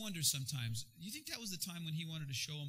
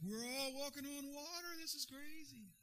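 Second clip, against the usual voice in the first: amazed disciples; 10 semitones higher, 8 dB quieter than usual. Retold or imitated. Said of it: "And they're all like".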